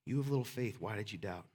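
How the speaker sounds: low, hushed, tender question; gentle reproach. Acted. Jesus asks the one who doubted, gentle reproach. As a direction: let it sink down softly to almost nothing.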